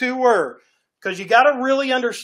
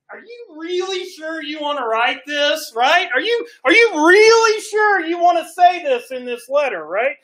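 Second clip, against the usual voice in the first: frantic plea; 6 semitones higher than usual. Retold or imitated. Proofreader begging John not to write it, frantic plea. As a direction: beg him to reconsider, voice breaking up.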